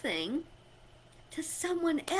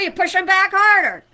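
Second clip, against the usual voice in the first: loud, higher and fast, shoving the words out; a mock fight voice that jumps way up and louder; pushing back harder; 6 semitones higher, 13 dB louder, faster than usual. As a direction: act out shoving back twice as hard. Escalating push, acted shove back.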